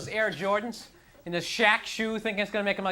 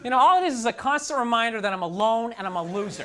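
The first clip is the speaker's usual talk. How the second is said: ordinary talk, nothing marked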